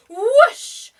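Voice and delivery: a big, high whoosh; loud whoosh. Acted. Storyteller making the penguin fly, loud high whoosh. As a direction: blast out the whoosh.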